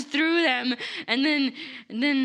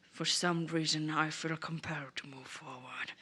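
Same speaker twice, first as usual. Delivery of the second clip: mysterious murmur; 8 semitones lower, 11 dB quieter than usual. Retold or imitated. Lila speaks mysteriously.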